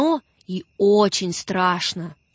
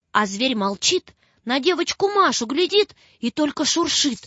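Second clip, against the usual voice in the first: ordinary delivery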